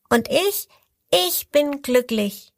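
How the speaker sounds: the fifth crocodile beams with joy; happy crocodile. A narrator's reading. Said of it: "Das fünfte Krokodil lacht".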